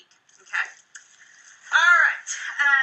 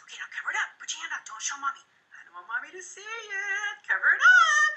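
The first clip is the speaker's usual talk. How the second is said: a hint of a high, hushed voice for a toddler; hushed coaxing a child; 8 semitones higher than usual. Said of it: "I'll be".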